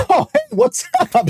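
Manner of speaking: very high, delighted dog greeting; sky-high cheerful doggy hello; happy dog voice. Pretend. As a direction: squeal it like an overjoyed pup.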